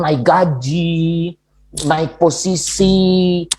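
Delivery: slow, stretched-out words for big wishes; drawn-out listing. Acted. Preacher imitating people who save thanks for a raise, drawn out. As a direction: drag out each grand wish.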